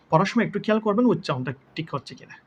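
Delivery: usual speaking voice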